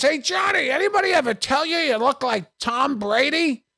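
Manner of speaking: put-on loud, high-pitched drunken fan voice; drunk husband hollering. Drunk fan at the end of the line, hollering. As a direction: pester him at a drunken holler. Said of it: "the husband's drunk".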